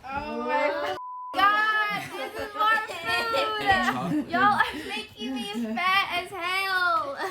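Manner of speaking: surprised shout. Real, not acted. More food arrives, she exclaims.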